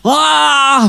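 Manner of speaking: high wild yell; demon shout. Acted. Possessed uncle yelling at the boy, high shout. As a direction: let out a demon's cry.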